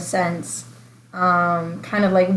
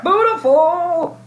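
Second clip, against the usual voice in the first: excited; 8 semitones higher, much slower than usual. Pretend. Cheering everybody be beautiful.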